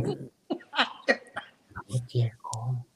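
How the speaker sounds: almost inaudible, low "llegó"; faint broken reply. Retold or imitated. Bride answering the planner, faint and low. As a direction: let it barely come out.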